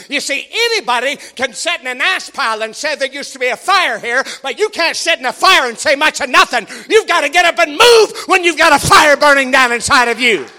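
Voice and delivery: building to a loud shout; preaching. Real, not acted. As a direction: climb to a fiery shout. Preaching at full force.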